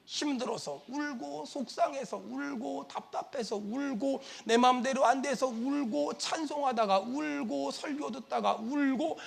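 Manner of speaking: hushed, slow lament; sorrowful listing. Retold or imitated. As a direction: draw out each item softly and wearily. Listing the elders' reasons for weeping.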